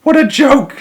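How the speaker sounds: high squeaky jeer; shrill bird mockery; jeering robins. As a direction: sneer it up high like a mocking bird.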